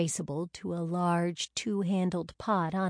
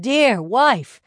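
dear wife called even louder and higher; louder exclamation; 5 semitones higher, 11 dB louder than usual. Acted. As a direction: lift and push dear wife harder. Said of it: "exclaimed the man".

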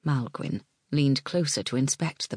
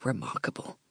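whispered; 4 semitones lower, 7 dB quieter than usual. Acted. Malgwyn speaks in awe.

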